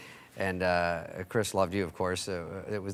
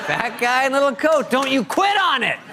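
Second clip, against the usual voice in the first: high, loud, laughing mock Farley yell; Chris Farley shouting; 14 semitones higher, 5 dB louder than usual. Pal acting out Farley cheering himself on, a high laughing yell. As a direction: bellow it like Farley through laughter. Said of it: "He goes".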